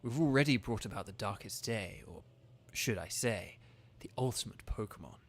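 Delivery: understated menacing Chairman Rose; reading Rose's lines. Acted. Reads Rose's villain lines.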